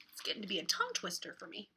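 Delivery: usual speaking voice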